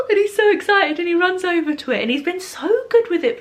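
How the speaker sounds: high, fast and laughing; excited. Real, not acted. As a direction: gush with delight, laughing. Delighted mum describing toddler.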